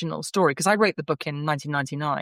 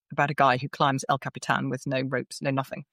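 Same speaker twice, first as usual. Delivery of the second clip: ordinary delivery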